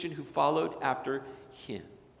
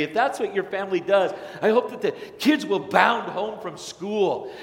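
louder, higher, driving preacher cadence; preaching; 6 semitones higher, 8 dB louder than usual. Acted. Pastor contrasting sin and Jesus for the congregation, forceful preaching. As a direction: thunder it from the pulpit.

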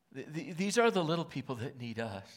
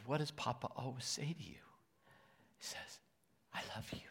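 hushed tender whisper to a grandchild; 6 dB quieter than usual. Retold or imitated. Grandpa whispering to his little grandson, soft and hushed. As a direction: breathe it softly like bedtime.

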